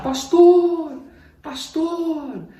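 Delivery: high, loud desperate calls; shouting for the pastor. Retold or imitated. Desperate man shouting for the pastor from the churchyard, loud calls. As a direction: yell across the yard for help. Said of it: "eu comecei a gritar no pátio".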